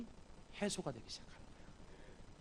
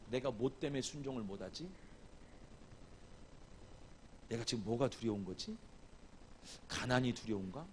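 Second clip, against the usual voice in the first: softer, lower self-questioning; quiet reflective question to oneself; musing to oneself; 4 semitones lower, 9 dB quieter than usual. Acted. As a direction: turn inward, quietly wondering. Models asking oneself inwardly.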